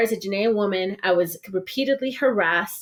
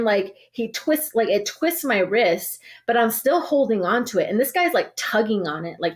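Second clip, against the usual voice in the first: ordinary delivery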